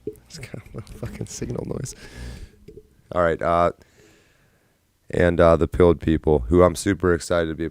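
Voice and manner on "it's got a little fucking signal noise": a hushed grumble to yourself; whispered aside. Real, not acted. Streamer grumbling about signal noise, whispered. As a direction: whisper it under your breath.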